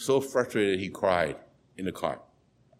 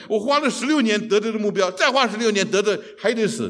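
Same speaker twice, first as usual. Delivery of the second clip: higher, louder frustrated cry; despairing outburst; 9 semitones higher, 5 dB louder than usual. Retold or imitated. Doctor telling the psychiatrist his goals are pointless, loud despair. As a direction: voice climbing in hopeless protest.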